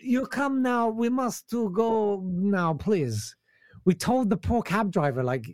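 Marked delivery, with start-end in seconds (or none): 0.0-3.4 put-on slow, pleading Ukrainian accent. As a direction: urge him away in broken English. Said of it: "a Ukrainian accent".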